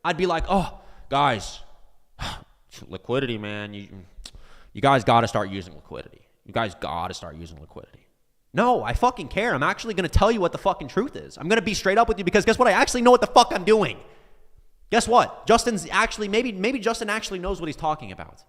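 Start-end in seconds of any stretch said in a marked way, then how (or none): 0.5-8.1 low-pitched, sighing mock indifference; put-on listless guru; mock uncaring. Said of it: "If I didn't care, I'd be like". 8.5-18.4 loud, raised-pitch impassioned rant; fired-up, emphatic outburst; passionate. Real, not acted. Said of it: "I am just so passionate".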